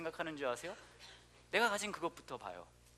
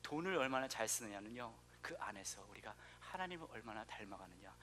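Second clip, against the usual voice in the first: usual speaking voice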